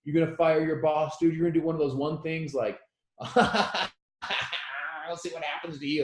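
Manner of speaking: mock him through a belly laugh. Coworkers laughing at his dream, mocking through laughter. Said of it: "laughing like a belly laugh".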